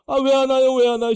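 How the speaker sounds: loud, high nagging repeat; impatient calling. Retold or imitated. Family calling from home, loud impatient demand. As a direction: demand an answer down the phone. Call from home asking why.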